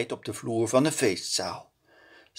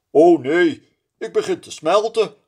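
high, loud startled cry; frightened cry; 7 semitones higher, 7 dB louder than usual. A narrator's reading. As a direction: snowman sees himself melting. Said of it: "roept hij verschrikt". (verschrikt roepen, hoog en luid)

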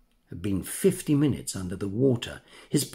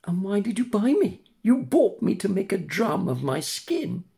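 put-on high, cheeky puppet boy; mischievous wooden boy, teasing; 8 semitones higher than usual. A narrator's reading. Pinocchio voiced.

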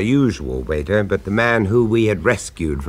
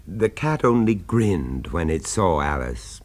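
ordinary talk

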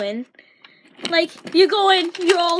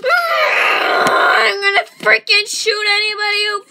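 loud hammed-up shout; mock battle yell; 3 semitones higher, 5 dB louder than usual. Player charging the building, yelling wildly. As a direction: roar like charging in. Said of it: "And they're like".

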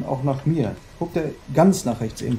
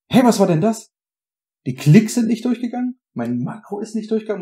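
higher, louder indignant cry; indignant outburst; 8 semitones higher, 5 dB louder than usual. Real, not acted. Gamer whose macro failed, indignant outburst. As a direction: protest that the clicks failed.

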